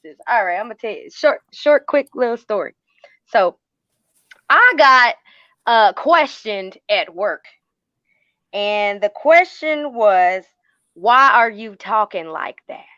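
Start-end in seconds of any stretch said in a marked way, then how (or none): 4.5-12.8 slow Southern country drawl; country twang. Shown as a demonstration. Telling the hosts about being questioned at work, country twang. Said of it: "Did you just put twang on your voice just now?".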